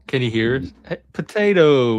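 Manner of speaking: ordinary talk, nothing marked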